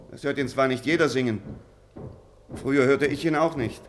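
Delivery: ordinary talk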